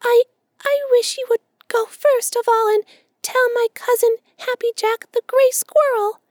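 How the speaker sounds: very high, stammering squeaky voice; hesitating squirrel. A narrator's reading. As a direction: falter through it like an uneasy squirrel. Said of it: "speaking in a hesitating way".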